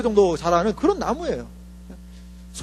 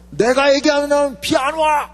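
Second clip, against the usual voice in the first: loud, higher defiant shout at the king; retold shout; 6 semitones higher, 4 dB louder than usual. Preacher voicing Elijah before the king, loud defiant shout. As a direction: shout it at the king like a fearless prophet. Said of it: "막 소리 지르고".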